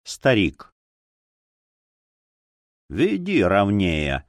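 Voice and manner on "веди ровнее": slow, low grumble; slow grumbled order to a driver; grumbling. Acted. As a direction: grumble it low, dragging the words out. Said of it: "проворчала Зора Ринч".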